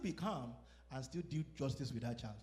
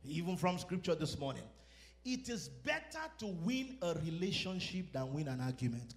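normal talking voice

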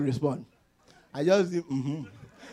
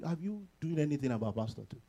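ordinary delivery

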